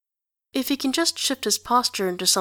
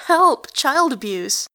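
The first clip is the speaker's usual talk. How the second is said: playful shriek; 6 semitones higher, 5 dB louder, slower than usual. Acted. Kid shrieking in play.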